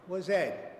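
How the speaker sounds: usual speaking voice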